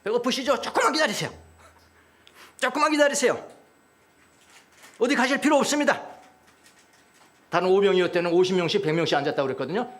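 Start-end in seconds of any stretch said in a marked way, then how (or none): 0.0-6.0 high, projected announcement to a crowd; acted disciple calling to the multitude; addressing a crowd. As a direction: reassure a big crowd with a lifted voice. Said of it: "많은 무리를 향하여".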